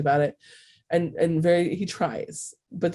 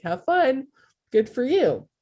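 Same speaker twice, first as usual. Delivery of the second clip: higher, lightly voiced cheery send-off; mock approval; 7 semitones higher than usual. People cheering on your cheeseburger and fries, mock approval. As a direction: imitate easygoing approval with a lift in pitch.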